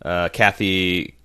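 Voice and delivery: ordinary talk, nothing marked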